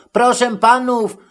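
high, polite inquiry; Matołek asking politely. A narrator's reading. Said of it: "więc zapytał".

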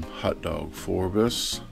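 usual speaking voice

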